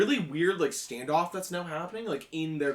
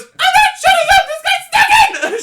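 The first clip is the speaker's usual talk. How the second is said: very high, very loud shriek; B shouting back; 22 dB louder than usual. Acted. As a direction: scream back at her brother. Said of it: "she goes".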